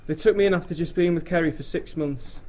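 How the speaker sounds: normal talking voice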